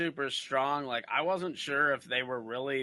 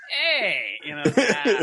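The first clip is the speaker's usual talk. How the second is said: Minnesota cop greeting; 5 semitones higher, 8 dB louder, slower than usual. Retold or imitated. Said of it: "go right into character".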